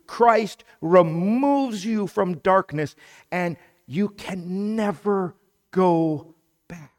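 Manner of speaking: high, loud preaching climax; rising pulpit fervor; fiery preaching. Acted. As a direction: drive the promise home with force. Preaching at full force.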